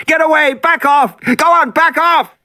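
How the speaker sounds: shouting at the top of his lungs. Acted. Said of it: "Regis was shouting at the top of his lungs".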